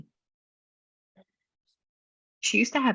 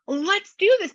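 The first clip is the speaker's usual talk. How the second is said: much higher, bubbly cheerleader voice, partly whispered; mock excited push; 14 semitones higher, 4 dB louder than usual. Teasing partner, mimicking her excited nudging. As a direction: hype her up like an eager friend. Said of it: "she's going to try to make you excited".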